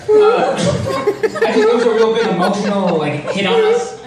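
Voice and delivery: high, slow laughing; can barely get it out; laughing through words. Real, not acted.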